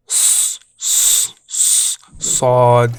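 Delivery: heavy back-of-tongue S in "s, s, s, sod"; strong emphatic S. Shown as a demonstration. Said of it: "Strong and connected".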